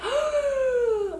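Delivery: sharp high gasp of panic; mock gasp. Student hearing bad news from advisor, high gasp. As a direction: gasp as if the news just hit.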